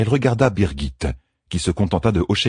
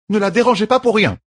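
higher, steady caregiver's voice; firm midwife; 4 dB louder than usual. A narrator's reading. Midwife explains the treatment to the queen, firmly. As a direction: lay out the rules kindly but firmly.

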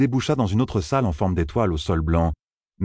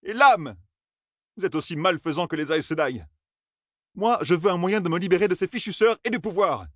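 put-on higher young man's voice, demanding and exasperated; frustrated young man; 12 semitones higher than usual. A narrator's reading. Matt cursing the silent strangers, higher exasperated voice. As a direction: push the questions like an impatient youth.

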